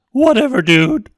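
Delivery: put-on high, loud dismissive teen; loud teen brush-off; bratty teen. A narrator's reading. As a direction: toss it off like a snotty kid. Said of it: "the kid muttered".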